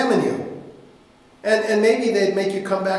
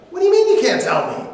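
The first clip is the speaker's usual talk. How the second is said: indignant protest; 11 semitones higher, 6 dB louder, much faster than usual. Acted. Wife protests.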